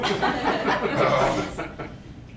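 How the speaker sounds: real laugh after shouting. Real, not acted. Trainer after yelling at the webcam, laughing. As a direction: laugh off your own mock yell.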